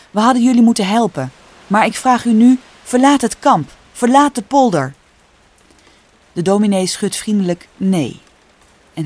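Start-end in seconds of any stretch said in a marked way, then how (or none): 0.1-4.9 louder, higher, insistent plea; earnest, forceful urging; urgent appeal. A narrator's reading. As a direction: press them to leave, voice raised. Farmer urges congregation to evacuate.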